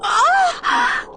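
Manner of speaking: sudden very high yelp of pain; cry of pain. A narrator's reading. Irene falling in a hole, high yelp. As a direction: yelp as the ankle twists. Falls with twisted ankle.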